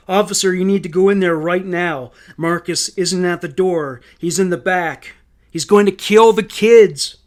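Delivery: frantic, insistent appeal, rising at the end; urgent plea. A narrator's reading. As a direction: beg an officer to act right now. Frantic plea to police officer.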